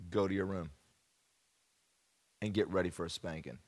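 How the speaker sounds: low, soft stern father warning; imitating dad's quiet dread-inducing order. Preacher voicing his dad sending him to his room, low and soft. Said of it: "my dad would just look at me and he'd say".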